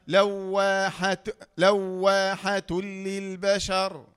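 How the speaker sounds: لواحة stretched slowly; slow recitation. Shown as a demonstration. Teacher models the word slowly for students. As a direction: recite لواحة للبشر drawn out. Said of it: "إذا حركنا باللام".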